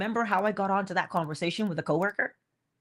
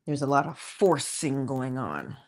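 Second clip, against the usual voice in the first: low, subdued delivery; low aside; 8 semitones lower, 4 dB quieter than usual. Shown as a demonstration. Speaker noting the strain in trying to be patient, low aside. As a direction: drop it low and slightly soft.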